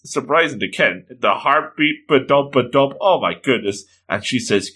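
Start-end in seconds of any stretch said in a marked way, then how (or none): none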